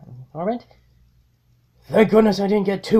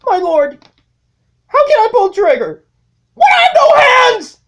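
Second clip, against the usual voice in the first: high, loud whining cry; a squeaky underling protesting; whiny minion; 8 dB louder than usual. Acted. Needle Nose interrupts Megatron with a high whiny cry.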